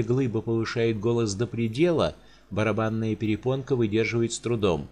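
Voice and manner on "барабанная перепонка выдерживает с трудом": ordinary delivery, nothing marked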